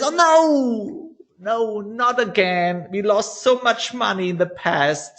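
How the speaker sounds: high, hammed-up wail of dread; fearful voice. Fearful inner voice begging against the trade, acted dread. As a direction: burst out high, then plead. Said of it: "the next voice is your fearful one".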